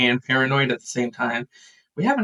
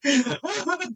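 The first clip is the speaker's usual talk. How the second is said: very high, soft squeaky wail; mock crying; 5 dB quieter, slower than usual. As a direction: imitate sobbing in disbelief, hammed-up. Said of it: "just crying in each other's arms".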